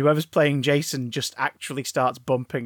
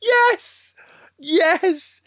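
shrill camp pantomime dame; 14 semitones higher, 4 dB louder than usual. Acted. Dame voice for Friday the 13th panto.

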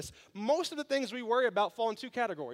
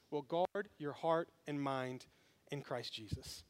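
scripture reading; 4 semitones lower, 4 dB quieter than usual. Acted.

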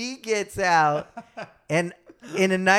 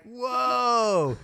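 retold whoa; 8 semitones higher than usual. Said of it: "And I'm like".

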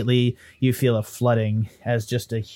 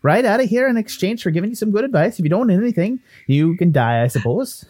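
put-on Irish-Canadian lilt, miffed; huffy ghost Willie; 9 semitones higher, much faster than usual. Ghost brushed off by the adventurer, huffy. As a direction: take mild offence as a chatty ghost.

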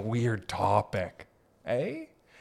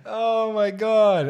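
cracking up; 9 semitones higher, 6 dB louder, much slower than usual. Real, not acted. Cracking up at own cat voice.

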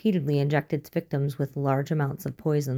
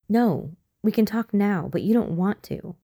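put-on a touch of a defiant girl, a little higher; 5 semitones higher, 3 dB louder than usual. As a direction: snap back, lightly stubborn. Hannah defiant retort.

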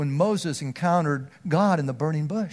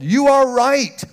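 louder, higher and slow; emphatic affirmation; 6 semitones higher, 6 dB louder, slower than usual. Acted. John telling the Greeks they are right, emphatic. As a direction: land each word firmly.

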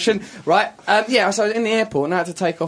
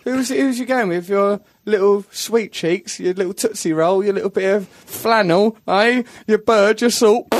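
mock leering teaser; 5 semitones higher than usual. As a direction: tease like a nosy dad. Mock leering dad teasing.

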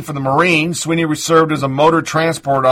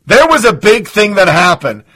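ordinary delivery

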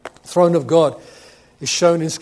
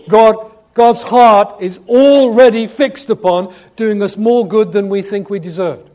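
loud, slow, raised preaching voice; loud preacher hammering each key word; emphatic preaching; 5 semitones higher, 10 dB louder than usual. Real, not acted. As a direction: drive it home from the pulpit. Preacher at full force.

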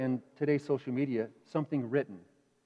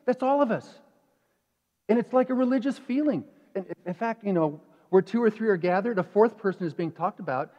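ordinary delivery

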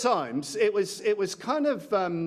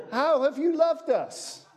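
high, slow whiny challenge; mock teenage whine with a laugh; sulky teenager; 9 semitones higher, 3 dB louder, slower than usual. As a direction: whine it back like a sulky teen. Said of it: "Kevin the teenager".

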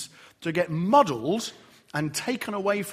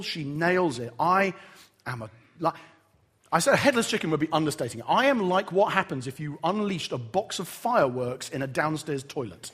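ordinary talk

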